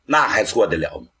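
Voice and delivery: ordinary delivery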